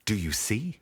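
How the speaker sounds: normal talking voice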